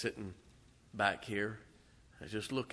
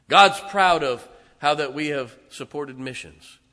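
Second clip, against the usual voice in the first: louder and higher with each God's proud of; full-force preaching; 3 semitones higher, 13 dB louder than usual. Acted. Pastor praising his church before God, rising rousing preaching. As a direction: build it like a rousing altar call.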